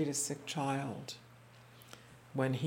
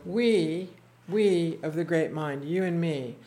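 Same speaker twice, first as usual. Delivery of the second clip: bold declaration; 3 semitones higher, 9 dB louder, slower than usual. Retold or imitated. Retold defiant reply.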